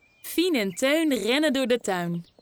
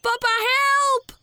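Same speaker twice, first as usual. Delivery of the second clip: high, loud, slow cries for papa; boy faking panic to fool papa; calling for help; 12 semitones higher, 5 dB louder, much slower than usual. A narrator's reading. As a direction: yell across the farmyard. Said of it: "roept hij".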